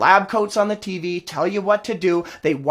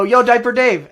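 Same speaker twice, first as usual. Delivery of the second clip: raised, higher taunting call of "Yo, Diaper Dave"; 5 semitones higher, 5 dB louder than usual. Retold or imitated. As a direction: re-enact hailing someone across the street. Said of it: "I called him Diaper, I go".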